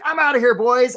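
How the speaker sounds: high, loud crab character voice; Charlie the crab. Acted. Coach acts out a crab leaving his buddies, crab voice. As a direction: call out as a departing cartoon crab. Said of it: "this is my crab voice".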